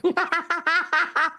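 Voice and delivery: sudden high laugh at his own joke; a real laugh breaking out high; high burst of laughter. Real, not acted.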